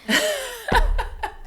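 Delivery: bursts into a high-pitched laugh. Real, not acted. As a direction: let a squeaky laugh escape. Laugh after quote.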